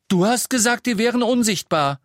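put-on high, loud, indignant boy; alarmed protest. A narrator's reading. Small boy warned of creatures by brother, alarmed protest.

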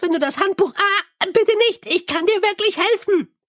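high, chirpy little imp. Acted. Imp soothes the angry Mumm, high and chirpy. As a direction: pipe it up high like a tiny helpful imp.

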